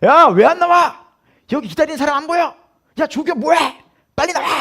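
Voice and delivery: high, impatient officer's yell; platoon leader's shout. Retold or imitated. Platoon leader hurrying the instructor out, impatient mock shout. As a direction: call out sharply from the back, imitating an officer. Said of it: "소대장님이 뒤에서".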